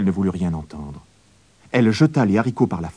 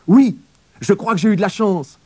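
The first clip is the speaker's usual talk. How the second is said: put-on much higher, proud little boy; boy's voice; 12 semitones higher, 6 dB louder than usual. A narrator's reading. Jacques tells his mother about his luck. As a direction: lift the voice high like a pleased child. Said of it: "répondit Jacques".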